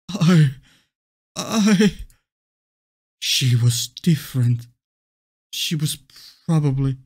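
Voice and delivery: halting stammer, starting and stopping; nervous confession, broken up; shaky, scared voice. Acted. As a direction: stumble through it like a frightened kid. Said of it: "Your voice is shaking like a scared child's".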